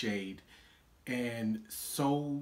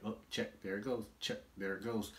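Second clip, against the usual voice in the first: muttered tally; 6 dB quieter than usual. Retold or imitated. Moviegoer checking off clichés to himself, quietly muttered. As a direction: keep it down, as if counting to yourself. Mimes ticking off clichés in theater.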